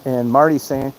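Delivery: ordinary delivery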